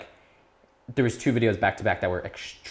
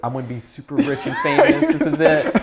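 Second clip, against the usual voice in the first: louder, higher laughing boast; giddy excitement; 6 semitones higher, 8 dB louder than usual. Retold or imitated. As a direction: gush like you've just hit the jackpot. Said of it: "I was like".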